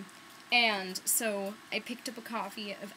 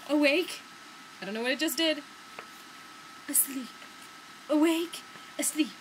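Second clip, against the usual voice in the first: playful announcing; 5 semitones higher than usual. Real, not acted. Playing with smart cover.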